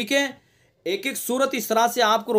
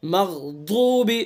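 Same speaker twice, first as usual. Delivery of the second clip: slow melodic tajweed chant; Quran recitation; slower than usual. Shown as a demonstration. Teacher reciting the verse slowly for students. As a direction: draw each syllable out in recitation.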